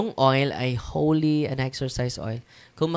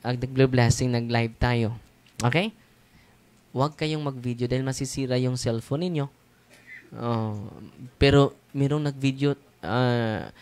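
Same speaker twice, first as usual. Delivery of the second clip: ordinary talk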